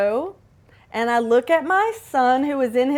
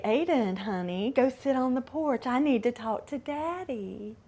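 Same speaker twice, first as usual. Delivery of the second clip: put-on much softer, gentle instruction to a child; soft calm voice; 11 dB quieter than usual. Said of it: "I lean in".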